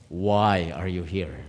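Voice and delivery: low, slow questioning; grave, deliberate question; solemn divine voice. Retold or imitated. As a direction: ask it slowly and low, as God confronting someone. Voice of God questions him.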